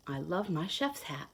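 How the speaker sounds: plain reading voice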